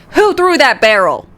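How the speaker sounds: a hint of a stern substitute teacher; lightly voiced scolding teacher. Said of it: "Substitute teacher voice".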